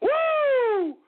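high, loud celebratory woo; whoop. Real, not acted. Host to listeners celebrating, a loud whoop. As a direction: let out a victory whoop.

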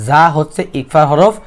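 ordinary delivery

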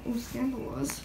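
usual speaking voice

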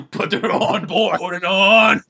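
loud, high shouted command; bullying order. Acted. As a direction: shout it as a hammed-up bully ordering someone around. Acting out Tony ordering Spider-Man.

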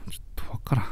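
soft, breathless whisper of confusion; whispered puzzled aside. Real, not acted. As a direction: mutter it under your breath, baffled. Baffled aside after listener's joke.

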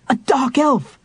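a high startled outburst; astonished. Acted. Tanis seeing the dark elf's face, high astonished outburst. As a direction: blurt it out in shock. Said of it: "Tanis said in astonishment".

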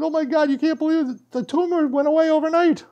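high, loud astonished news; imitating her amazed excitement; amazed and excited. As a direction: gush it out in disbelief. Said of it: "she goes".

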